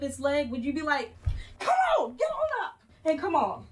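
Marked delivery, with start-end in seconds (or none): 1.6-3.7 high, loud mock parent yelling; mock impatient yell. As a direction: bark at the toddler like a harsh parent. Parent yelling at hurt toddler.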